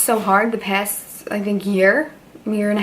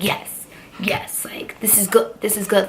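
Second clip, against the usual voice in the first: whisper; 4 dB quieter than usual. Retold or imitated. Private thrilled reaction, hushed.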